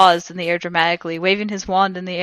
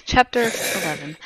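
usual speaking voice

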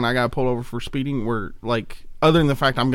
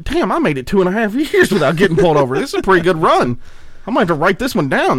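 cheerful self-quote; 6 semitones higher, 5 dB louder than usual. Retold or imitated. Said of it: "I'm like".

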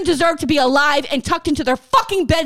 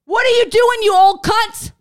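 raised, loud furious jab; real angry yell at a politician; angry outburst; 5 semitones higher, 4 dB louder than usual. Real, not acted. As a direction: lash out at her, pitch up and loud. Ranting at Pelosi.

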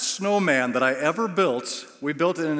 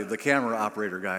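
ordinary delivery

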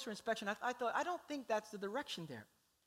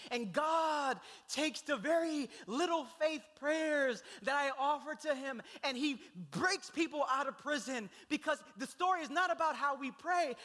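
louder, urgent sermon delivery; impassioned preaching; 6 semitones higher, 3 dB louder than usual. Real, not acted. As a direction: drive the point home to the congregation. Preaching with rising intensity.

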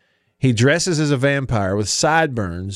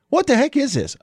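much higher, mock-bewildered listener; baffled listener; 13 semitones higher than usual. Listener who just tuned in, asking bewildered. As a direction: squeak it out like a lost listener. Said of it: "You're like".

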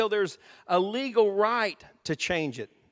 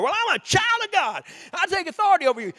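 much higher, loud defiant claim; defiant shout; 11 semitones higher, 6 dB louder, faster than usual. Retold or imitated. Believer arguing with the devil, high loud defiance. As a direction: stand up and declare it.

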